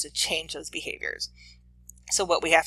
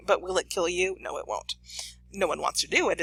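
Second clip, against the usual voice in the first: ordinary talk, nothing marked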